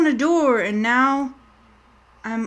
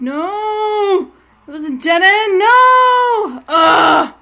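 real crying shout, higher and louder; despairing scream; 7 semitones higher, 8 dB louder, much slower than usual. Real, not acted. Streamer caught at a dead end, crying out. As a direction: wail as you get caught.

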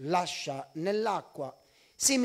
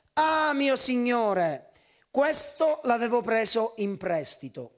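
crying out; 4 semitones higher, 5 dB louder than usual. A narrator's reading. Servant crying to the man of God over the lost axe, anguished cry. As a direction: stretch out the "Ah" as a desperate plea. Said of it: "si mise a gridare e disse".

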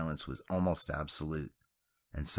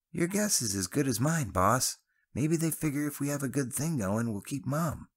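put-on cheerful young Travis, cut off mid-word; Travis's voice; 10 semitones higher than usual. A narrator's reading.

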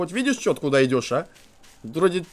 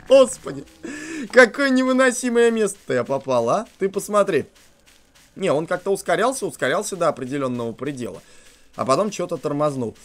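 usual speaking voice

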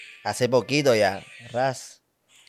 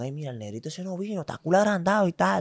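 gossiping sweepers; 5 semitones higher, 4 dB quieter than usual. Retold or imitated. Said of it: "le están diciendo allá a Aiton".

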